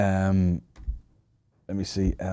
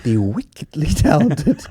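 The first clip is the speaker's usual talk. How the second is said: slow, drawn-out mock awards introduction; mock awards announcer; 4 semitones higher, slower than usual. Co-host mimicking a star's awards introduction, mock announcer. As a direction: announce it slowly in a put-on voice.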